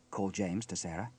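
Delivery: plain reading voice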